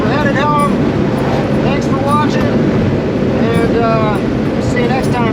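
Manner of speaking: loud, high, cheery goodbye; upbeat sign-off. Real, not acted. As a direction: wrap it up with energy.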